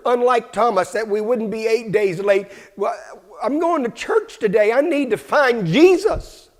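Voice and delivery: loud, fast-building shouted preaching; fiery revival preaching; preaching at full force. Acted. Preacher climaxes the sermon.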